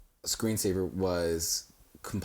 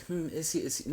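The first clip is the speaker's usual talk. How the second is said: higher, prying insinuation; mock suspicious gossip; 8 semitones higher than usual. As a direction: imitate a nosy doubter. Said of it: "people to be like".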